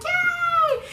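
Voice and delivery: very high, loud group-style hooray; squealed mock celebration; imitated cheer. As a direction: cheer like a delighted crowd.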